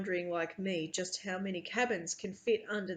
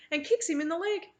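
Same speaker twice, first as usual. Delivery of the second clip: usual speaking voice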